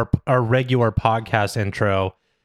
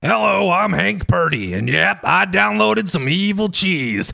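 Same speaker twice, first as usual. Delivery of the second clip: slow, higher put-on guest voice; interviewee voice; 5 semitones higher than usual. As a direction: play a folksy interviewee slowly. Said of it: "I'll play that interview person".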